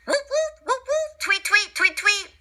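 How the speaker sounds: bird calls. Acted. Twilight bark animal calls.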